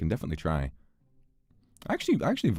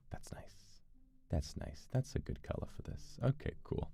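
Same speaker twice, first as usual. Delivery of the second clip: hushed, breathy whisper to himself; 18 dB quieter than usual. Real, not acted. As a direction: whisper so nobody hears. Quietly admiring colours in game.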